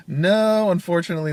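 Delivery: exaggerated, regretful punchline delivery; comic movie reply. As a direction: burst out with a hammed-up denial, then ease down. Acts out comic movie exchange reply.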